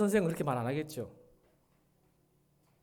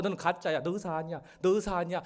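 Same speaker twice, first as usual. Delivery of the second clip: insistent needling, raised pitch; 김사부; 4 semitones higher, 4 dB louder than usual. Retold or imitated. Mentor provoking the young doctor, mock insistent accusation. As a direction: keep jabbing with the same accusation. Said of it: "자꾸, 자꾸 얘기하는 거예요".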